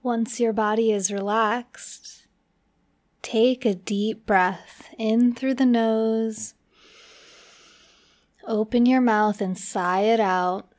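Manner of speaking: unhurried meditation guide with long pauses; measured, spacious delivery for relaxation; guided meditation. Acted. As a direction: ease the listener slowly into relaxing.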